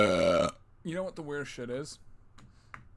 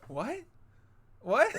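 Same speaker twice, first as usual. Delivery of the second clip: confused clown; 10 semitones higher, 6 dB louder than usual. Pretend.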